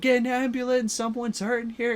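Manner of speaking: high, urgent retold call for help. Peter calling out to the swat team, high urgent call. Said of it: "starts calling out to the swat team".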